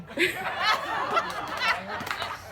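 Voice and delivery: high laugh at her own story. Real, not acted. Storyteller laughing at Lenny's prank. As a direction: break into laughter.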